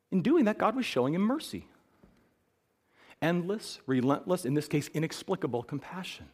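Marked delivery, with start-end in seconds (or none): none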